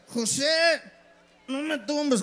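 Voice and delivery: put-on high-pitched pleading drunk; borracho tambaleante. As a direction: call out high like a staggering drunk. Borracho habla solo por la calle. (borracho suplicando en voz aguda)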